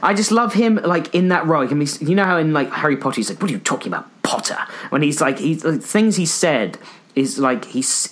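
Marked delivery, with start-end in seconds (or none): 3.4-4.6 hissed, sneering Malfoy whisper; spitting sneer. Retold or imitated. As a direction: spit the words out in a sneer. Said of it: "it's almost like spitting out".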